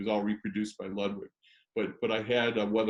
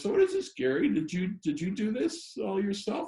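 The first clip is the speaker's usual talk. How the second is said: much higher, bright impressed woman's voice; imitating Barbara; 10 semitones higher than usual. Barbara asking Gary about the drum, high imitation. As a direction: put on Barbara's voice, amazed. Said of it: "she goes".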